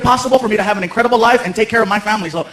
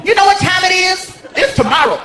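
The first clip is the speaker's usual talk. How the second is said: higher, put-on voice of a grumpy hotel clerk; sassy night clerk; 8 semitones higher than usual. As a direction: snap at the late guest like a fed-up clerk. Clerk scolding.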